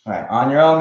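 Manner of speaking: ordinary talk, nothing marked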